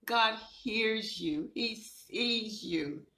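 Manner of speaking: ordinary talk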